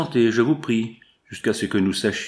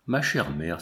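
low, hushed entreaty; pleading exclamation; 6 semitones lower, 3 dB quieter than usual. A narrator's reading. Isabelle at Hippolyte's feet, pleading exclamation. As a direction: plead gently in a lowered voice. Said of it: "s'écria Isabelle en se jetant à ses pieds".

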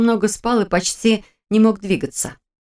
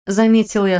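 ordinary delivery

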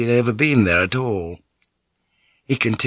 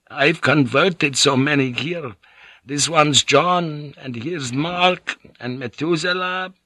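put-on higher, eager old monk voice; a frail old monk proudly showing his flock; frail old priest; 5 semitones higher than usual. Narrator voices Father Domingo.

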